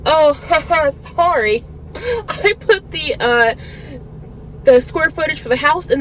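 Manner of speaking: high-pitched, fake giggly apology; mock sheepish apology. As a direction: imitate a careless laughing excuse. Mocks appraiser's sheepish excuse.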